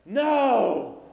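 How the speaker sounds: shrill high shocked scream; a mother's horrified cry of no; mom's horrified no. Retold or imitated. Mom screams no at her son's news. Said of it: "the mom was like".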